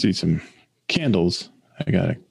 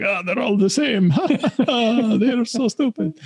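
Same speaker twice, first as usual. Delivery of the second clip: high, mocking put-on voice with a laugh; odd accented guy; 13 semitones higher than usual. Shop guy sneering at customers in a high put-on voice. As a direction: play the dismissive guy in the back, pitched way up. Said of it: "I don't know why he has that accent".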